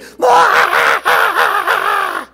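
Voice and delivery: long, loud, high pulsing bawl; hammed-up child's wail. A narrator's reading. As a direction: burst into exaggerated bawling. Child bursts into tears.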